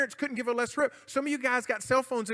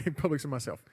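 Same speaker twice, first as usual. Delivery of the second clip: laughing; 7 semitones lower than usual. Real, not acted. Laughs at own joke.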